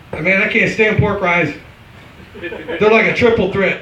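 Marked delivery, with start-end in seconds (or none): none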